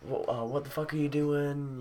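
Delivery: high-pitched mock scolding; imitated yelling dad. Dad at the car to teen, mock yelling. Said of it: "he's yelling at me".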